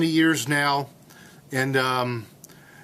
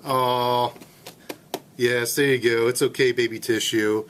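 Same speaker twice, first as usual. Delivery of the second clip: mock cooing; 5 semitones lower than usual. Cooing to tissue box.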